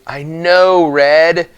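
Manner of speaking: annoyed retort interrupting Red's warning; irritated outburst. Acted. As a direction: cut her off, fed up. Rump cuts off Red's lecture.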